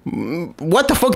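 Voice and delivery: normal talking voice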